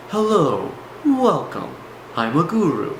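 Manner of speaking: guru talk. Shown as a demonstration. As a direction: put on a welcoming guru voice. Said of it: "what I call the guru talk".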